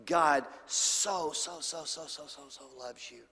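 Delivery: "so" repeated, fading from soft to almost silent; repeated "so" trailing down to a breath. Shown as a demonstration. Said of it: "the emphasis on the "so" that never stops".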